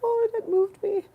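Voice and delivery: exaggerated teary squeal; mock tearful gush. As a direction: overplay being moved to tears. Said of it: "I was like".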